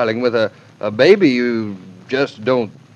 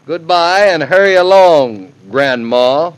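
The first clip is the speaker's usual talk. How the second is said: loud, raised, emphatic sign-off; hearty command; 4 semitones higher, 6 dB louder than usual. Acted. Son-in-law hurrying the new grandma along, loud and hearty. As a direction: send her on her way with gusto. Husband ends blustering phone call.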